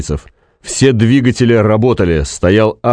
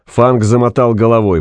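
ordinary delivery

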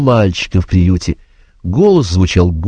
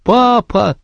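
put-on high crying-out little boy; child's cry. A narrator's reading. Joe crying out to the sergeant in a high child's voice. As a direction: call out for papa.

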